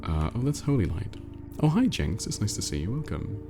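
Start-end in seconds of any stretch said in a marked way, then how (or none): none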